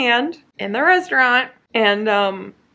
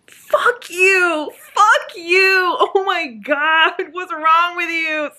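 higher, half-crying fake fury at the characters; mock outrage; 9 semitones higher, 3 dB louder, much slower than usual. Real, not acted. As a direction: scream at the page in exaggerated disgust. Yelling at book characters.

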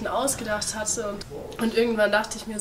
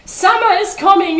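high, loud cry of alarm; aghast, high-pitched yell; horrified shout; 11 semitones higher, 14 dB louder than usual. A narrator's reading. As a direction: scream a dire warning. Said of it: "schrie der Pinguin entsetzt".

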